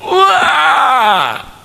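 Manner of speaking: long, very high wail; retold yell of pain. Preacher re-enacts yelling behind the closed door, high wail. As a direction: cry out over a hurt hand, hammed-up. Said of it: "Saya teriak".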